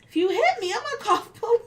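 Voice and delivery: high-pitched, loud mock threat; retold high warning. Worker retells warning client about hitting, high exclaimed threat. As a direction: pitch the warning way up, playing it for the listener.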